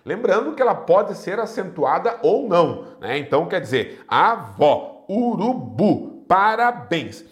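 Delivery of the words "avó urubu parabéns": "avó, urubu, parabéns" broken up, final syllable punched; stress on last syllable. Shown as a demonstration. Teacher demonstrating oxytones, last syllable stressed. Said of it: "a última sílaba seja aquela pronunciada com mais força".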